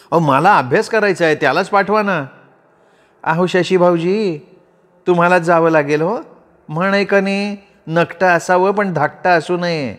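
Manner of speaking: lightly teasing. A narrator's reading. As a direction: tease the younger brother, no real laugh. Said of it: "वहिनी हसत हसत म्हणाल्या".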